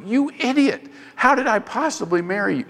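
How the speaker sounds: a hint of an annoyed wife; mock exasperated wife. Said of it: "Helen would slap me ... and say".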